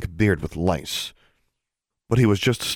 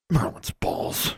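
drawn-out, mostly unvoiced swearing; muttered curse; 10 semitones higher, 4 dB quieter, slower than usual. A narrator's reading. Startled person cursing in the dark, muttered curse. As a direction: curse slowly under your breath. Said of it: "someone cursed".

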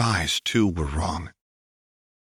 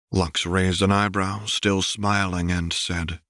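usual speaking voice

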